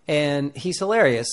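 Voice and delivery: ordinary talk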